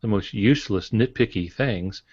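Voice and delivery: normal talking voice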